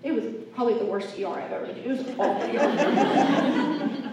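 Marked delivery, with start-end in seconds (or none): 1.8-4.1 laughing. Real, not acted. Laughing at the awful ER.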